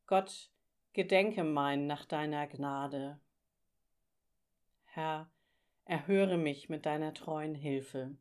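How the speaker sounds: slow, hushed prayer voice. Acted. Prayer spoken in devotion. (ruhiges, gedämpftes Gebet)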